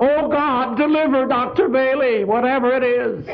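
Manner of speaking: put-on woman praying over her baby cries out for Doctor Bailey, high and pleading; retold cry to God. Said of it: "I cried out".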